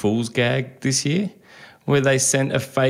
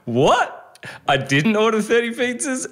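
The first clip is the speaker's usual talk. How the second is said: high-pitched incredulous customer; mock disbelief; 11 semitones higher than usual. Customer reacting to a bill for pizzas, mock astonished. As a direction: squawk it in hammed-up shock.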